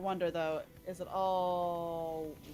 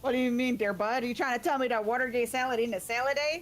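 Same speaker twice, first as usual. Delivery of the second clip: raised, hammed-up Canadian accent; mock Canadian accent; 3 semitones higher, 5 dB louder than usual. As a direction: play an affronted Canadian in broad accent. Hamming up Canadian or Minnesotan accent.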